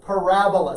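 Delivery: Parabola, punch the ra and keep the rest light; stress on the second syllable. Shown as a demonstration. Teacher giving students the word to repeat, stressing ra in Parabola. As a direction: model Parabola for the class with the stress on ra. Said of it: "Emphasis on the second syllable".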